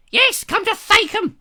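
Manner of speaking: high, loud screech of a Monty Python housewife; screeching woman impression. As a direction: screech her name across the room. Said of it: "stepped out of a Monty Python film".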